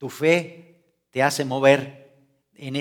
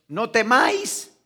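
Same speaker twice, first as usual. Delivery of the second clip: "No temáis" raised and slowed; gentle command; 7 semitones higher, 4 dB louder, slower than usual. Shown as a demonstration. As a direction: give "No temáis" slowly and firmly. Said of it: "les ha dicho a los discípulos". (mandato pausado: "No temáis")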